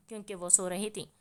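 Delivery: ordinary delivery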